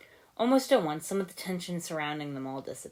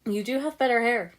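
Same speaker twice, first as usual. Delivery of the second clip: higher, louder teasing jab; joking Jay; 8 semitones higher, 7 dB louder than usual. A narrator's reading. Jay teasing Lloyd about his hair, joking jab. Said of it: "Jay joked".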